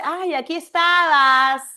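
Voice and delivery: loud, happy "Ay, aquí estabas" with a lift on "estabas"; excited friend's surprise. Shown as a demonstration. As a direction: play the friend who finally finds you, delighted. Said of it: "¿Por qué te dice".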